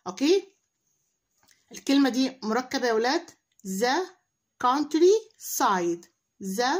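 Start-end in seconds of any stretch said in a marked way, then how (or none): 4.6-6.0 drawn-out teacher model; slow model pronunciation. Shown as a demonstration. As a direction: stretch each syllable slowly. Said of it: "احنا ممكن نحفظها كلمة كلمة".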